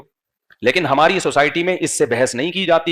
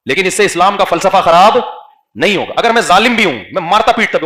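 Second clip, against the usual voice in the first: louder, higher forceful preaching; 3 semitones higher, 5 dB louder than usual. Acted. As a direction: build it up to a pulpit cry. Preacher raising his voice.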